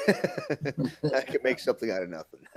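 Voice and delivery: slow words breaking out of laughter; amused, chuckling as you speak; laughing. Real, not acted. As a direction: say it through a laugh. Joking reply amid laughter.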